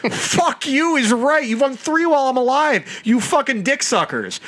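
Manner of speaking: high-pitched, worked-up tirade; indignant rant. Real, not acted. Heated rant at rival team's fans.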